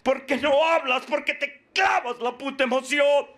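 high-pitched, forceful scolding from the podium; fiery preaching. Acted. Speaker confronts the struggling addict, fiery preaching.

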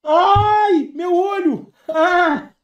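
high, loud cry of pain. Real, not acted. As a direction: yelp out in sudden pain. Sudden pain in eye. (grito de dor)

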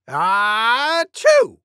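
high, drawn-out pretend achoo; loud mock sneeze. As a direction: build up slowly into a big high sneeze. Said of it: "Maybe as loud as this".

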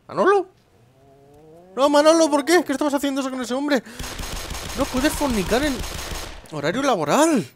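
hammed-up high scold; scolding Manolo. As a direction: play a shrill boss scolding his worker. Gamer voices scolding his character.

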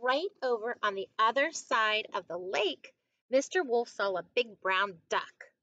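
put-on story reading to children. A narrator's reading. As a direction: read it out clearly for young listeners. Reads a picture book to children.

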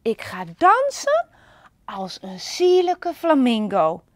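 bubbling with a new idea; eager announcement. Acted. Panda deciding to dance like a flamingo, eager and loud. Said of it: "riep Panda".

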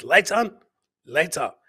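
ordinary delivery

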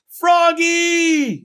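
very high, loud parental yell. A narrator's reading. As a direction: yell the child's name through the house. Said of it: "yelled his mother and father".

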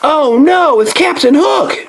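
high mock alarm. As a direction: gasp at the villain, playful. Mock alarm at toy pirate.

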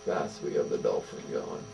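whispered aside. Real, not acted. Musing aloud.

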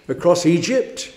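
ordinary talk

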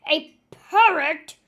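loud, slow scornful roar; slow booming outrage; outraged roar. Acted. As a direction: bellow in disgust. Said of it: "he roared".

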